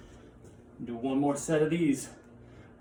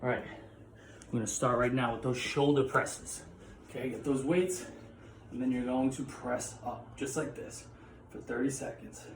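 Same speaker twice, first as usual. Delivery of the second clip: softer, breathless coaching mid-workout; out of breath; 7 dB quieter than usual. Real, not acted. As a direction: push the words out between reps. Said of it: "so I'm just gonna talk being out of breath the whole time".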